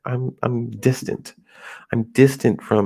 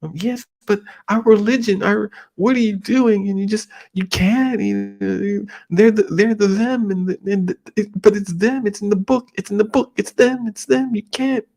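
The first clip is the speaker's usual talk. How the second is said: put-on high, flustered older relative sputtering; mock outraged parent voice; 8 semitones higher than usual. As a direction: splutter like a scandalized elder. Parent objecting over religion.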